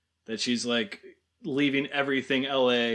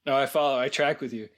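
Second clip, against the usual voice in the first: normal talking voice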